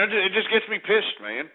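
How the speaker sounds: normal talking voice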